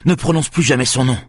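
high, angry narrated command; yelled warning. A narrator's reading. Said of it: "hurla Axel".

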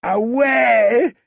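very high "Ah ouais" with a long rise; ecstatic. A narrator's reading. The children admiring the wound, ecstatic. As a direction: gasp in delight together. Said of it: "s'extasièrent plusieurs d'entre eux".

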